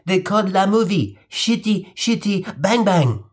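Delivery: put-on lightly French-accented boy, slow; a kid saying the title with a French lilt. French boy proudly naming the film, lightly accented.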